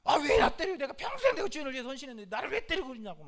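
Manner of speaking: loud, high, indignant outburst; acted-out protest of a wronged servant, rushing as it goes on; angry donkey. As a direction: yell back in hurt outrage. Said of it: "나귀가 화가 나가지고".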